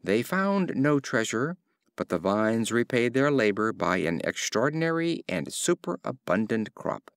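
plain reading voice

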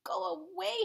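high-pitched storybook "shout", held back; a touch of grouchy yelling; mild grouchy shout. A narrator's reading. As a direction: shoo them off crossly, without real volume. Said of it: "shouted the grouchy ladybug".

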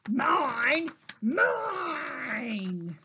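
loud, drawn-out stubborn yell. A narrator's reading. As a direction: yell it slowly, hammering each word. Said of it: "Minosaur yelled".

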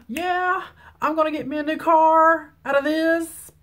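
high, excited pretend cheer; mock celebration. Imagining the wreck was her other car, mock-thrilled. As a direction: play up gleeful delight, pitched well above normal.

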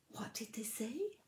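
soft whispered question. Acted. Reader teasing the listening child, whisper. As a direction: whisper it to build suspense. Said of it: "reader whispers question".

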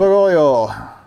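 put-on loud, hammy Slavic-accented cry. Streamer joking about his reading of the name, in a hammed-up Slavic accent. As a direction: throw out a bold mock Slavic exclamation. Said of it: "Slavic with the accent".

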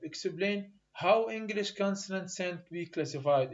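reading the exam question very slowly, word by word; slow dictation of an English exam question. Shown as a demonstration. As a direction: spell out each word for students to copy. Teacher reads exam question aloud.

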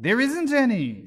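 high, loud, slow tease; spring the joke on her; teasing. A narrator's reading. March Hare mocking Alice over the wine, high and slow.

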